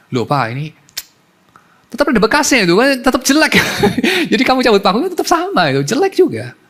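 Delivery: put-on high, loud childish protest with laughter; a kid whining through a laugh; laughing child complaint. (anak protes sambil tertawa, suara tinggi)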